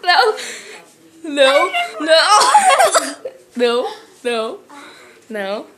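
loud pained cries of no; mouth burning from spicy food. Real, not acted. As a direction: cry out as the pepper burns. Reacting to the hot pepper.

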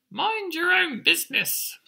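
very high, loud chick retort; chirping chick. Acted. Emu chick answers back to the birds, high loud chirp. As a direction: chirp it cheekily, right up in falsetto. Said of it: "But Emu Chick chirped".

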